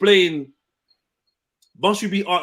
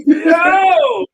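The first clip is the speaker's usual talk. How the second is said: shouting out; 13 semitones higher, 6 dB louder than usual. Real, not acted. Calling out loudly.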